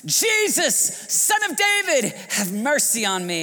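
very high, loud desperate plea; soaring cry for mercy, easing at the end; all-out shout. Acted. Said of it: "he shouted all the more".

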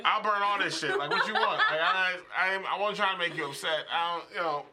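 high, loud panicked pleading; frantic apology. Retold or imitated. Boyfriend desperately apologizing to crying girlfriend, loud and high. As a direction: beg her loudly. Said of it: "and I'm like".

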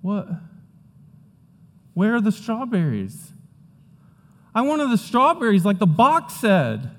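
put-on high, whiny child voice; upset little kid. First-grader discovering no strawberries.

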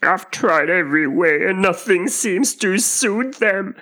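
high, sobbing pigeon wail; exasperated, tearful whine, pitched high; kind of sob. A narrator's reading. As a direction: lament it loudly in a bird voice. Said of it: "added with a kind of sob".

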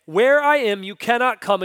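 loud, high declaration of Jesus; booming quote of Jesus; Jesus's words, ringing out. Retold or imitated. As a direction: proclaim it loudly as Jesus. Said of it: "Peter heard Jesus say".

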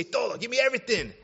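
high, loud and quick mock order; eager ordering. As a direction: blurt it out like a hungry customer. Acts out ordering Mexican food.

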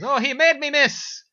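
high, loud stage Irish; hammed-up Irish accent. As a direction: belt it out in a cartoon Irish accent. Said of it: "That was not the right accent".

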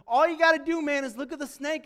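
loud, high-pitched urging of a friend; acted urgent shouting. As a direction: call out as if to someone dying on the ground. Friend urging a dying snakebite victim.

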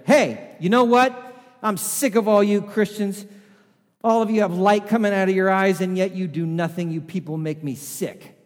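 high, loud furious yell at Christians; shouting in angry disgust. Retold or imitated. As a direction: blast them with disgust, shouting. Angry stranger confronts Jeff.

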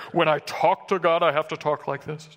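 lightly put-on pious prayer voice; mock prayer tone. Mocking people who pray in a special tone, lightly pious voice. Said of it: "this special tone of prayer".

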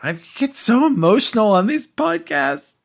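high, emotional, close to tears; choked up. Real, not acted. Podcast host moved by talking about family at Disney, choked up. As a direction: voice rises as if about to cry. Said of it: "I'm gonna start crying".

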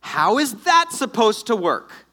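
high, loud, drawn-out disbelief; incredulous outburst. Acted. Reacting to an angel's impossible promise, incredulous outburst. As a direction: stretch the question in mock astonishment.

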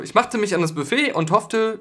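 normal talking voice

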